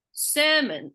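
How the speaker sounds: "sermon" with SER high and strong; stress on first syllable. Shown as a demonstration. Teacher quizzing a student, SER stressed in "sermon". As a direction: punch SER, let "sermon" fall away. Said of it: "tell me where I'm emphasizing".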